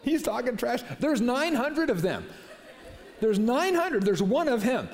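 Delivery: gleeful, half laughing, climbing high; excited. Real, not acted. Preacher relishing the story to his congregation, gleeful and high. Preacher delighted by Elijah's taunts.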